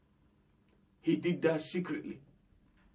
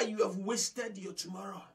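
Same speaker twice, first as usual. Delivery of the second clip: ordinary talk, nothing marked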